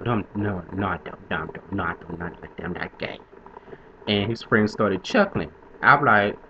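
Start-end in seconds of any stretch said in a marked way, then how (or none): 0.1-3.2 soft, trailing-off mock denials; low, muted mimicked protest; retold backpedaling denial. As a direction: imitate someone backing down, dropping lower and softer. Retold flustered denial, backing down.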